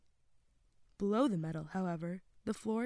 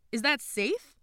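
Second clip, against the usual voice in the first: raised, higher questioning voice; Alejandro shouting back; 6 semitones higher, 9 dB louder, slower than usual. A narrator's reading. Boy doubting the soundboard is safe, shouting back. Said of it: "Alejandro shouted back".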